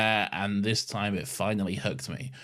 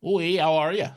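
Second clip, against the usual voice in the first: put-on Canadian accent; 7 semitones higher, 4 dB louder than usual. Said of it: "I like doing the little accent".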